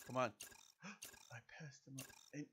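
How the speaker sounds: usual speaking voice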